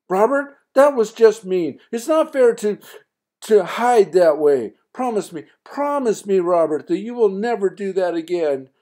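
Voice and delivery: high, loud angry mother; mad mother. Acted. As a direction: scold him, hurt and upset. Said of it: "Mom's mad".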